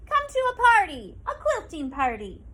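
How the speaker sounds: put-on old woman calling the animals in; shouted invitation. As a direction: sing out the invitation to all. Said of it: "shouted the old woman".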